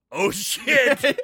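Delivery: loud, high panicked yelp; panicked cop. Retold or imitated. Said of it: "the police officer goes".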